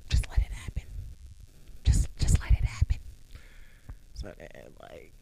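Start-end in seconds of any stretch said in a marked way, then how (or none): 0.1-3.0 hushed, whispered inner voice; resigned whisper. Retold or imitated. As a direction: whisper it to yourself. Inner voice while being cuddled.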